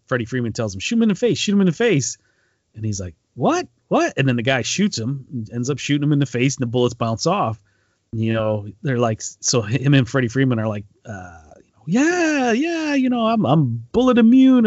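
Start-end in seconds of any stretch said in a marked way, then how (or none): 0.8-2.2 high, urgent egging-on; retold movie line, pushed up high and eager; urging shout. Retold movie scene, urging shot. 3.3-4.1 high squeaky double what; mock surprise in a high rising voice; startled. Retold robber's startled reaction. 11.9-14.7 high, loud boastful cheer; hammed-up boast, pitched high and louder; cocky bragging. Retold heroes bragging about immunity.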